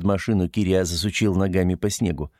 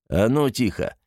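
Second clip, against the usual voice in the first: usual speaking voice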